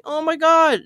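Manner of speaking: high, gushing fangirl gasp; excited. Retold or imitated. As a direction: gush with delight. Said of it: "I was like".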